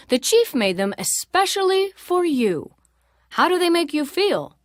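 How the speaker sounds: mild storyteller uncle; lightly voiced uncle. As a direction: tell it like a well-travelled uncle.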